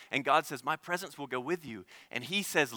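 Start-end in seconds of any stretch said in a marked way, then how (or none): 0.6-1.9 soft reassurance. Acted. God answering Moses softly.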